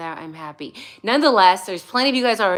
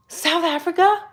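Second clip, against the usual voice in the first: high gasp then South Africa? rising; thrilled surprise; excited gasp; 9 semitones higher than usual. Real, not acted. Reacting to a viewer from South Africa, surprised.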